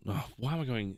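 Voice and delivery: ordinary talk, nothing marked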